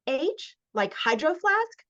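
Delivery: blurted question, pitched high. A narrator's reading. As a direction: blurt it out, puzzled. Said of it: "I blurt".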